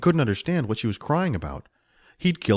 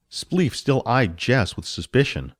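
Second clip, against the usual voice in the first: ordinary delivery, nothing marked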